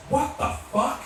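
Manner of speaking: high, startled what the fuck; a mock gasp of shock at the total; shocked outburst. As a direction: blurt it out in disbelief at the price. Wife shocked at grocery bill.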